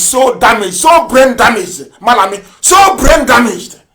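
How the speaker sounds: angry. Real, not acted. Angry tirade at official.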